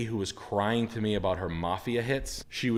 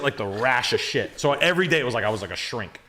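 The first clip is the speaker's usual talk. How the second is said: ordinary delivery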